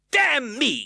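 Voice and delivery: high, loud outburst of rage; bursting rage. A narrator's reading. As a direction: explode in rage. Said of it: "bursting out with the rage he had kept down".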